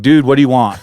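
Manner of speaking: half-shouted demand, retold; retold yell. Camper calling out to a possible Bigfoot, retold yell. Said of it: "I yelled at it".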